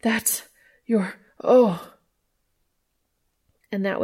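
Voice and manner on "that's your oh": broken-off words into a louder gasp; whimpering. A narrator's reading. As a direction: lose the sentence, end on a higher "oh". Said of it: "Dean whimpered".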